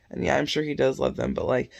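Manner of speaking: normal talking voice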